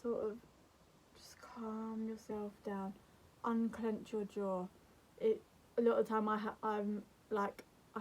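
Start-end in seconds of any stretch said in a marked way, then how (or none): 1.2-4.7 soft, slow calming voice; soothing. Shown as a demonstration. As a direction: ease them down slowly and quietly. Demonstrates calming down from panic.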